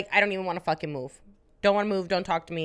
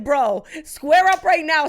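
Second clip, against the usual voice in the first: loud, high, drawn-out dare; fight challenge; 7 semitones higher, 7 dB louder, slower than usual. Retold or imitated. Sister daring brother to square up, loud retold challenge. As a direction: call someone out to fight, slow and loud.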